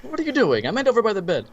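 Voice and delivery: high-pitched, playfully indignant scolding; imitated fussy correction; mock exasperation. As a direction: act out an impatient boss, lightly. Acted scolding of burdened old lady.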